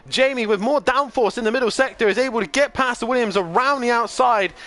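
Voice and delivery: rising, urgent play-by-play; excited race commentary. Acted. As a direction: call the overtake with building excitement. Live race commentary building.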